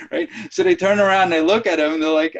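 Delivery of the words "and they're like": ordinary talk, nothing marked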